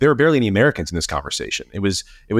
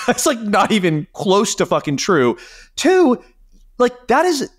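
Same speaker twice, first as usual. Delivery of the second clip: climbing much higher in exasperated disbelief; 9 semitones higher than usual. Real, not acted. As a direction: push the pitch way up, fed up and incredulous. Exasperated rebuttal of N-bomb claim.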